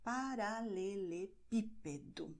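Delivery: Paralelepípedo slowly sounded out with PÍ stressed. Shown as a demonstration. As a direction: spell out Paralelepípedo by syllables, leaning on PÍ. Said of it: "so you stress it".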